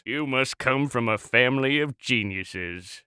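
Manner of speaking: low, deadpan sarcastic jab; withering low aside; dry sarcasm. Acted. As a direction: drop the pitch and mock drily.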